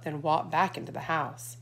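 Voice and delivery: plain reading voice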